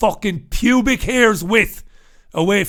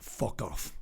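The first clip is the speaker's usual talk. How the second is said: disgusted mutter; 11 semitones lower, 16 dB quieter, slower than usual. Real, not acted. Disgusted asides under breath.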